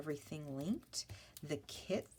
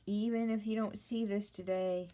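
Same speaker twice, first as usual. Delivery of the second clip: emphatic plea; 6 semitones higher, 7 dB louder than usual. Real, not acted.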